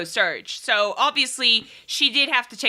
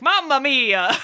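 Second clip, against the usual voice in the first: mock Italian; 3 semitones higher, slower than usual. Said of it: "an Italian person who's always like".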